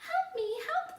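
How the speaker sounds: very high, loud squeal; bunny crying for help. Acted. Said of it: "the bunny cried".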